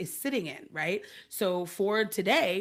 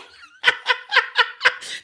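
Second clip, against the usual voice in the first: high, loud peals of laughter; hearty laughter; 11 dB louder than usual. Real, not acted. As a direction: laugh hard and long. Laughing about crying without knowing why.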